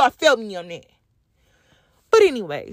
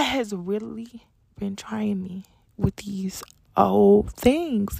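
whisper; 5 semitones lower, 3 dB quieter, much slower than usual. Real, not acted.

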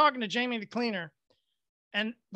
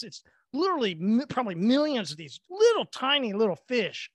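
ordinary talk

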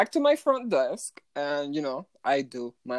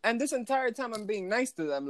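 normal talking voice

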